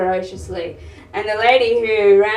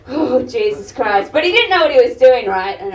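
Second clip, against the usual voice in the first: ordinary talk, nothing marked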